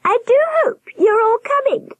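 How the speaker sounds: very high, warbled falsetto of a giant nurse; warbling. A narrator's reading. Nurse greeting the staring children, high warble. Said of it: "she warbled".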